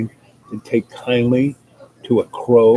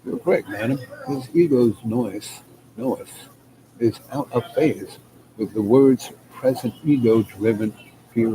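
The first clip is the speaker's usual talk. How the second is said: ordinary talk, nothing marked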